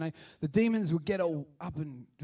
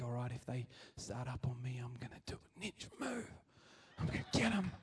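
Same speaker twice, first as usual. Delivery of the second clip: whispered, softer secret plan; whispered inner thought; 4 semitones lower, 11 dB quieter than usual. Retold or imitated. A shopper psyching himself up against mean guys, whispering. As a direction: whisper your tough-guy plan to yourself.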